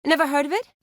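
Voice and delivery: high-pitched, thrilled exclamation after a gasp; gasp, then burst out high and eager; excited delight. Acted. Spotting a party game at a wedding, excited delight.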